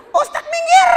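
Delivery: high, loud laughing yell at the preacher to move; fangirl yell. Retold or imitated. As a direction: squeal it like a girl pushing to the front. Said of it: "teriak semuanya". (teriakan tinggi sambil tertawa)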